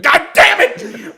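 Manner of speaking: high, drawn-out cry of frustration; mad. Retold or imitated. Son hearing dad cursing below, mad outburst. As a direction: let the annoyance burst upward. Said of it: "I'm so mad".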